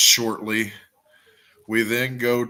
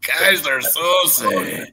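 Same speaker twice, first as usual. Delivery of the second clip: slow, drawn-out name, high and loud; playful name call; 8 semitones higher, 6 dB louder, much slower than usual. Pretend. Co-host echoing a film villain's name, mock-dramatic.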